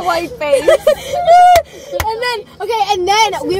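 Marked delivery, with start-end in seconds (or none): 0.7-2.0 loud high burst of real laughter. Real, not acted. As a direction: crack up out loud.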